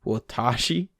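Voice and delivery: "Watashi" stressed on the middle, wuh-TAH-shi; "Watashi" with warped first vowel and long, stressed TAH; foreigner's mispronunciation. Shown as a demonstration. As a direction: say "Watashi" like an English speaker, leaning on TA. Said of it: "This is the foreigner".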